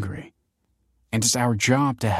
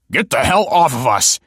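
loud, higher, angry man's shout; forceful protesting yell; struggling cry; 6 semitones higher, 8 dB louder than usual. A narrator's reading. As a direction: shout while fighting off a grip. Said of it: "cried the man, struggling".

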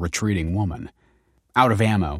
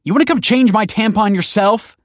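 high, loud irritated yell; 14 semitones higher, 10 dB louder than usual. Acted. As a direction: yell through the door. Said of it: "she yelled".

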